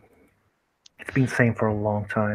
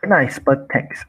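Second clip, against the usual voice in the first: ordinary talk, nothing marked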